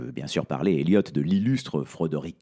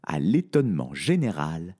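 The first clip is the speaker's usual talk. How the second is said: ordinary delivery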